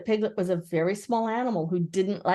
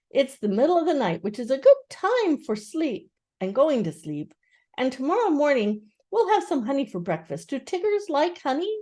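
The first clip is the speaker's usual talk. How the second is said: a touch higher, gentle bear voice; lightly voiced Pooh; 7 semitones higher than usual.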